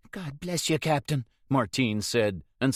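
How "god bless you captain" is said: high, pleading Irish lad; a frightened young lad begging, pitched high; scared boy. A narrator's reading. Caught boy pleads with the captain, high and anxious.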